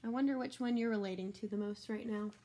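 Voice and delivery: usual speaking voice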